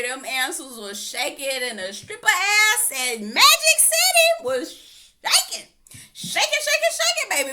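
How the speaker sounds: high, loud screaming laughter; laughing hard. Real, not acted. Mocking Mike's shaky answers, loud cackling laughter. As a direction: howl with laughter. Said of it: "laughing at answers".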